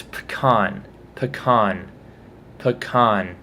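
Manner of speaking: "pecan" as "puh-KAHN"; insisted correct pronunciation. Shown as a demonstration. Correcting other video makers, "pecan" as "puh-KAHN". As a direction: say "pecan" with stress on a long "kahn". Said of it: "pronounced this nut correctly".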